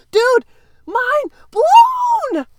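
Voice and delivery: high, loud mind-blown shout; excited shout. Retold or imitated. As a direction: yell it in amazement. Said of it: "And I was like".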